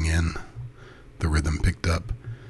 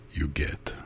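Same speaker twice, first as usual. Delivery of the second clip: hypnotic cadence; 2 semitones lower, 6 dB quieter than usual. A narrator's reading. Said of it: "His voice took on its hypnotic cadence".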